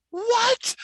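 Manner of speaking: very high-pitched incredulous cry; astonished. Retold or imitated. Boss hearing about the red balloon, high and astonished. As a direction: shoot the voice up in disbelief.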